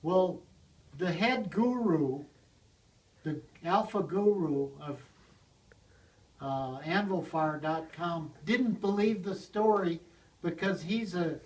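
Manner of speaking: plain reading voice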